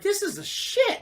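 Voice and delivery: high, slow relishing praise; savoring delight. Retold or imitated. Enemies turned friends, slow delighted praise. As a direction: stretch it out, relishing.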